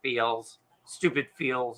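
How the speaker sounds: mock choked-up. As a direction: mumble it like fighting back tears. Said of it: "I was just like".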